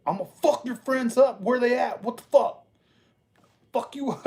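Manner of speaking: fired-up neighbor; ready to fight. Retold or imitated. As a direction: start a fight over the disrespect. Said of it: "I'm over like".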